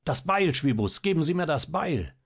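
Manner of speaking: high, insistent command; urgent demand. A narrator's reading. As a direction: demand it urgently, pitch jumping up. Doctor urgently demands the axe.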